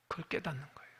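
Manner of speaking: soft, breathy whisper of the point; hushed confiding whisper to the congregation. Acted. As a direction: lean in and whisper it. Sermon point dropped to whisper.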